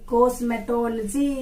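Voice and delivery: cosmetology as cosme-TOL-jee; corrected pronunciation. Shown as a demonstration. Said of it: "It is called cosmetology". (cosmetology को कॉस्मेटोल-जी बोलकर)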